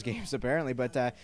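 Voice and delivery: ordinary talk, nothing marked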